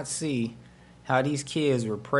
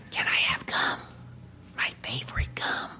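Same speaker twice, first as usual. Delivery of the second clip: secretive whispered request; imitated hushed, voiceless plea; child's whisper; 2 semitones lower, 6 dB quieter than usual. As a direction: whisper like a little girl asking a favour. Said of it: "I guess she's whispering".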